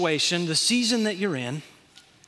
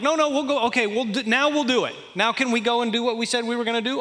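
put-on high, pleading kid whine; mock a begging child, fast at the end; whiny kids; 5 semitones higher than usual. Pastor imitating his kids begging, high whine. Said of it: "finally they're like".

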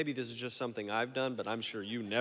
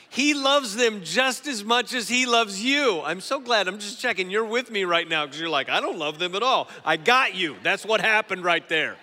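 loud, raised preaching voice; full-force preaching; 7 semitones higher, 10 dB louder than usual. Real, not acted. Preacher correcting his slip to the congregation, loud emphatic preaching. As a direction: drive it home loudly to the crowd.

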